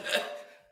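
a real sob that comes out as a laugh; tearful laugh-sob. Real, not acted. Tearful laugh-sob mid-testimony, overcome by emotion. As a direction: let the crying spill out as a laugh. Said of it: "I can't do this without crying".

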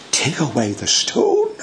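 high, disbelieving echo of the command; incredulous echo. Retold or imitated. Martha questioning the Lord's command, incredulous. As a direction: repeat it as if you can't believe it. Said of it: "And Lord, you say".

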